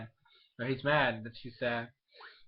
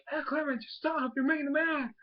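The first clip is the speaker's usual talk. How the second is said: very high-pitched mock panic; urgent pleading; 13 semitones higher than usual. Ash telling Clement to stop the machine, urgent pleading. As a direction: imitate a frantic plea in a squeaky-high voice.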